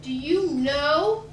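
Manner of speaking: slow, loud retold tirade, rising on the questions; appalled mother's yell, retold for laughs; mother screaming in outrage. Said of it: "she starts screaming".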